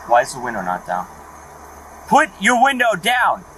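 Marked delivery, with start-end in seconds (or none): 2.1-3.4 higher, louder, slow command; shouted order. Real, not acted.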